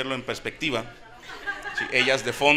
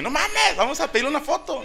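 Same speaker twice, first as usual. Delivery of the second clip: high-pitched fan excitement; a starstruck fan; excited disbelief; 13 semitones higher than usual. Retold or imitated. Reacción al enterarse. (emoción de fan, muy aguda)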